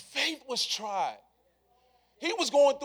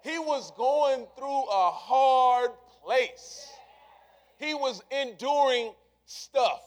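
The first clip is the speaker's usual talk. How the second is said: slow, heavy emphasis; 4 dB louder, slower than usual. Acted. Slowed, emphatic return.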